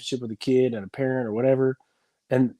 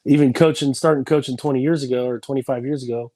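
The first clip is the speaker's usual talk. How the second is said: normal talking voice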